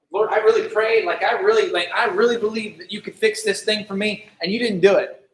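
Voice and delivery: loud, pressing, wounded prayer; hurt plea. Acted. Believer confronting God over unanswered prayer, loud and hurt.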